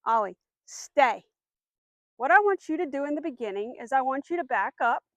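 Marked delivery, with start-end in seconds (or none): 0.1-1.2 slow, drawn-out firm command; firm, slow repeat of the stay command. Shown as a demonstration. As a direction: stretch the command out so he hears it. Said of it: "I wanna make sure he hears me".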